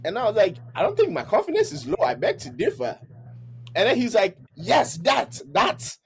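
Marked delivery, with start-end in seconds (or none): 0.7-3.0 high, quick retort that drops at the end; acted-out self-assured objection; confident pushback. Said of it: "you sound confident now". 4.5-6.0 high, emphatic pointing-out; acted-out excited affirmation; eager approval. Boss seizes on the confident tone.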